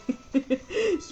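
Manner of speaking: short chuckle rising high; amused chuckle. Real, not acted. Daughter amused at father's troll joke, amused chuckle. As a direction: let out a little high giggle.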